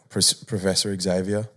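normal talking voice

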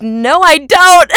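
high, drawn-out shouted denial; loud mock protest. Employee denying a British accent to her boss, loud mock protest. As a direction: cry it out in mock indignation.

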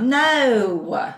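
one syllable stretched out, Arkansas drawl; Arkansas accent. Shown as a demonstration. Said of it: "they really draw out their words".

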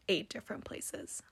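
whispered aside, no voice. Real, not acted. Whispering to Kiki about the bakery count. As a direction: breathe it out like a confession. Confiding the small number.

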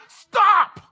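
high, explosive shouted halt; sudden command. Acted. Holy Spirit interrupting a racing mind, sudden high shout. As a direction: shout it like someone grabbing you mid-thought. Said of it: "the Holy Spirit just kinda grabs and says".

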